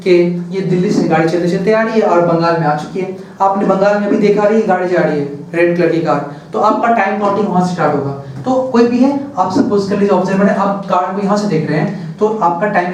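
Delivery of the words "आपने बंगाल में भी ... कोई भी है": normal talking voice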